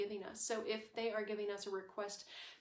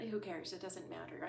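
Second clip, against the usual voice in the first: ordinary delivery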